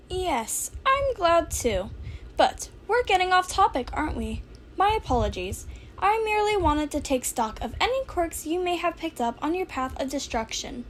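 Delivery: high, chirpy little principal voice; Nezu's voice. Acted. Principal welcoming student, high and chirpy. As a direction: lift the voice up small and polite.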